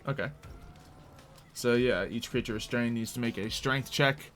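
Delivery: ordinary talk, nothing marked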